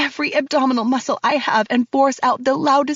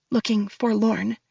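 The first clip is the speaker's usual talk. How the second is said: plain reading voice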